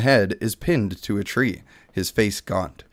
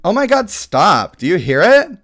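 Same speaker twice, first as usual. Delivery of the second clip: put-on Valley Girl; 8 semitones higher, 8 dB louder than usual. Streamer voices the Valley Girl elf for his viewers, high and loud. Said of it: "We got the Valley Girl".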